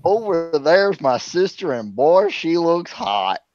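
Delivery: mock Iowa accent. Shown as a demonstration. Insulting Iowa with an impression, slow mock Iowa accent. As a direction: drag it out in a mock Iowa drawl. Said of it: "here's my Iowa accent".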